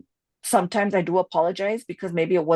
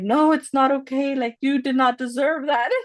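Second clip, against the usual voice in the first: high-pitched, imitated weepy protest; mock tearful; 6 semitones higher than usual. Wife refusing her husband's comfort, mock tearful. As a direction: retell it as a tearful whine of guilt. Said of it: "And I started crying".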